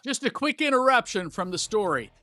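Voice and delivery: energetic ad read breaking into the show; promo read. Acted. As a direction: sell the subscribe pitch hard and upbeat. Host's promo break over music.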